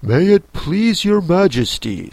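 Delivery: a touch of meek pleading; mildly humble. A narrator's reading. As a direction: address a queen with some deference. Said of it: "in a very humble tone".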